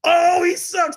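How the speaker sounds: high mock kid sneer. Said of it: "throw another pick".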